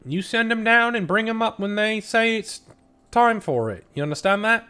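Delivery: high, bossy woman giving an order; Blue Nori voice. Acted. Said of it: "she says".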